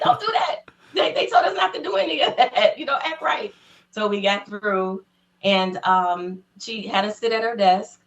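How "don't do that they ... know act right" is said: laughing scold. Retold or imitated. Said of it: "I was like".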